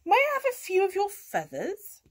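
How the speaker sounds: high rising request; cat asking. A narrator's reading. Cat asking a farm animal for things, high cat voice. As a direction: ask politely in a lifted cat voice. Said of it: "said Kat".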